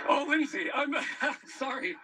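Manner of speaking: a real flustered laugh, then an apology; emotional laugh. Real, not acted. Anchor overcome on live eclipse.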